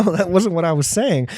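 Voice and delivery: usual speaking voice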